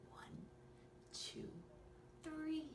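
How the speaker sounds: ordinary delivery, nothing marked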